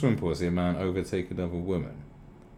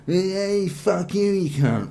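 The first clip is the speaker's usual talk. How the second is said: put-on higher, louder drunken snarl; mock drunk girlfriend yelling; 11 semitones higher, 7 dB louder, much slower than usual. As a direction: pick a fight like a drunk girlfriend. Acted pub quarrel, drunk woman.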